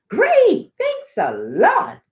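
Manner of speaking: high then slow, loud sarcastic thanks; sarcastic pigeon. Acted. Pigeon sarcastically thanking the children. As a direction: drip with sarcasm.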